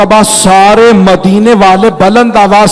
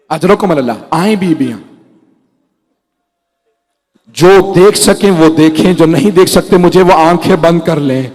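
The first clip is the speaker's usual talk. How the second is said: usual speaking voice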